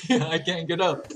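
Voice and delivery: slow words through real laughter; laughing. Real, not acted. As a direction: laugh helplessly while saying it. Laughs at failing to get up.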